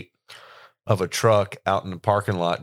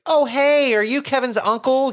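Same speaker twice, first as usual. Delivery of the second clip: bright, higher, welcoming call; friendly greeting voice; 4 dB louder than usual. Retold or imitated. Maeve greeting a wedding guest standing alone, higher and bright. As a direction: greet a lonely stranger warmly. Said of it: "and be like".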